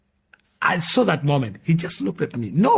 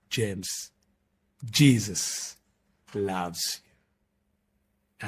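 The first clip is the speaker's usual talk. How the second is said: tender words; 4 semitones lower, 4 dB quieter, much slower than usual. Retold or imitated. Woman's tender words in hug.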